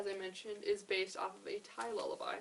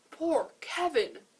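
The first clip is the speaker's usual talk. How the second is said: loud, high, slow cry of dismay; storybook scream; 7 semitones higher, 12 dB louder, much slower than usual. A narrator's reading. Porcupine hearing of Kevin's fall, loud exclamation. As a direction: wail it out for poor Kevin. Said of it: "Porcupine screamed".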